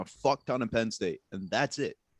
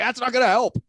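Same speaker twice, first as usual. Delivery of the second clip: high, loud mocking comeback; answering the manager with a mocking high retort; sarcastic retort; 12 semitones higher, 8 dB louder than usual. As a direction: snap back much higher and louder.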